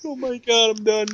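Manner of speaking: ordinary delivery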